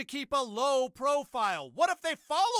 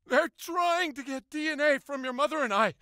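usual speaking voice

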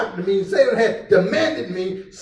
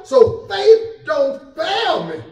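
very slow, high, shouted plea; preaching shout; 8 semitones higher, much slower than usual. Acted. Preacher pleading with his faith, slow shouted climax. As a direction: cry it out to the rafters.

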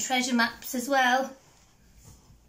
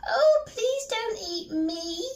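high bouncy rabbit voice; little rabbit; 13 semitones higher, 5 dB louder, slower than usual. Acted. Rabbit answers the troll under the bridge, high squeak.

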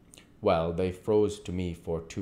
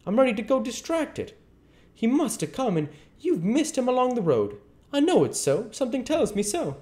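very high, anxious aunt voice; a worried farm wife near distraction, pitched way up; fretful Aunt Sally; 4 dB louder than usual. Acted. Aunt Sally frets to Silas that the boy was missed, very high and anxious.

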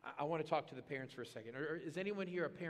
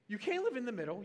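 ordinary talk

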